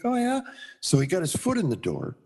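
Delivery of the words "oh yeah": piqued surprise. Retold or imitated. Retold reply to stranger.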